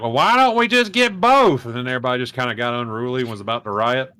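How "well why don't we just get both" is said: high, loud, slow indignant demand; a moviegoer calling out to the manager; mock indignant heckle; indignant guy. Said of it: "one guy stood up and was like".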